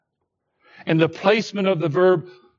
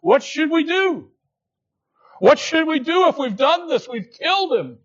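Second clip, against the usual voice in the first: higher, anxious cry of the crowd; distressed plea; 8 semitones higher than usual. Retold or imitated. Preacher voicing the guilty crowd asking in distress. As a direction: voice their alarm, a touch pleading. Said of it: "ask in deep distress".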